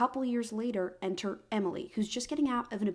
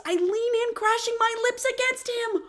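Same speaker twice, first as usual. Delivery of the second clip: high, loud, breathless swept-up reading; excited reading; 13 semitones higher, 7 dB louder than usual. A narrator's reading. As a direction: read it like you can't contain yourself. Dramatic climax of the romance.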